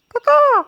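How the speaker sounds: high caw-caw imitation; bird call. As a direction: squawk like a crow.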